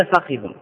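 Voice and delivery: فخذ as fa-khi-dhun; three-syllable فخذ: fa-KHI-dhun; original full form. Shown as a demonstration. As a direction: say فخذ with a, then i on the kha. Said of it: "اللغة الأصلية".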